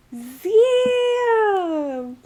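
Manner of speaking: loud high frustrated damn; frustrated exclaim. Real, not acted. Reacting to the rejection with a loud damn. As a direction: blurt it out, stung.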